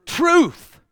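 high, loud single word; preached answer. Real, not acted. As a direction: ring out the answer. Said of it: "I am the what?".

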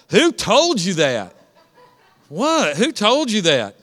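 emphatic parent question. Shown as a demonstration. Pastor showing the congregation how to answer, firmer. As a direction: say it with conviction this time. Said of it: "what kind of parents are y'all?".

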